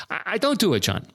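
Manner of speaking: a hint of Doctor Ruth refusing; Doctor Ruth impression. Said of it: "I can't do a great impersonation".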